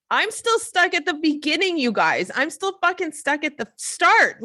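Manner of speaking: high, loud exasperated protest. Retold or imitated. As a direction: plead your case, pitched high and forceful. Exasperated answer to her accusers.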